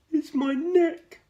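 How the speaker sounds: sad giraffe. A narrator's reading. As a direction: whine it like a cold, sad giraffe. Giraffe complains to George.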